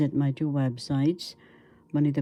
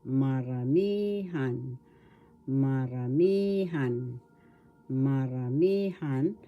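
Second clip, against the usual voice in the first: "maramihan" slowly, ma-ra-MIII-han; stress on third syllable; slower than usual. Shown as a demonstration. Teacher showing where the accent is, slow "maramihan" stressed on MI. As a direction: stretch the MI in "maramihan". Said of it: "The accent is in the third syllable".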